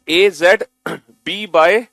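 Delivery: slow, loud, drawn out letter by letter; slow loud recital. Shown as a demonstration. Teacher showing the class how to read it, slow and loud. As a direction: chant each letter pair slowly and loudly. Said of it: "कैसे पढ़ना है देखो".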